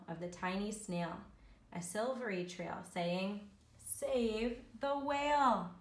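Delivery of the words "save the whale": high, loud, stretched-out call; urgent appeal. A narrator's reading. Snail's silvery trail begs help, calling out. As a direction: proclaim it slowly like a rallying cry.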